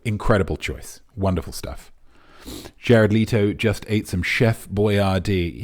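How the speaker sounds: ordinary talk, nothing marked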